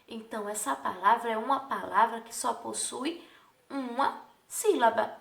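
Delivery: ordinary talk, nothing marked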